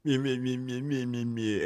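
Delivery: dismissive bleating meh; mocking meh. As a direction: imitate a bored shrug. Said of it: "that's all I hear out of anyone".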